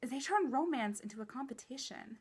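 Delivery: normal talking voice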